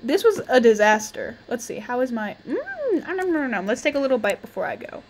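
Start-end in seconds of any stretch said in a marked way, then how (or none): 2.5-3.7 high hum into playful nom-noms; cartoonish mock chewing sounds; pretend munching noises. Player taking a little bite in game, playful munching. Imitates eating food in the game.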